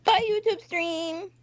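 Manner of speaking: high, cutesy farewell; chirpy goodbye to the stream; singsong sign-off. Real, not acted. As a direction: wave off the viewers in a high voice. Signing off to the stream.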